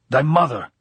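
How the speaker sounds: loud, high-pitched outburst; angry shout. A narrator's reading. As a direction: shout the curse at him. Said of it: "Augustine shouted at him".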